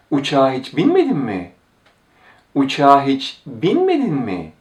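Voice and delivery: astonished swoop up on "binmedin", then falling; surprised question. Shown as a demonstration. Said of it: "It sounds like an expression of surprise".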